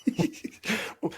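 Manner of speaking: high airy laugh; a silent wheezing laugh; breathless laugh. Real, not acted. Laughing at a story.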